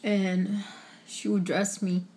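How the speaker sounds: real tears pushing through, with long pauses between words; holding back tears. Real, not acted. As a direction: fight to keep from crying while talking about her grandmother. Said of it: "I'm trying not to cry".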